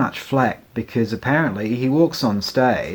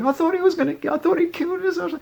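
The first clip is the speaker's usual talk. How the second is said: squeaky impersonation of mum; mum retelling; faster than usual. Retold or imitated. Mum retelling the scare, voiced very high. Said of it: "she's like".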